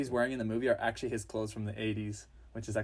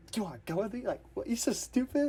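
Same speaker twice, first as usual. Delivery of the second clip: self-mocking; 10 semitones higher than usual. Boy mocking himself after asking.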